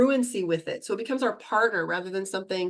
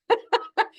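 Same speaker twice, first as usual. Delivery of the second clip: bright laugh; 13 semitones higher, 4 dB louder than usual. Real, not acted. Laughing at her own joke.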